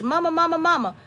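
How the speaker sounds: a hint of pestering children; imitating pestering kids. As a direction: lightly imitate children calling nonstop. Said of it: "they were calling me so much".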